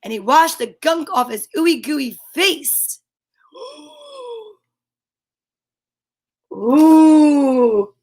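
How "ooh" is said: 1 surprised gasp. Real, not acted. Reader gasps at the picture.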